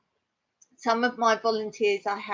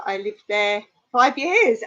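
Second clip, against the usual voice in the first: ordinary delivery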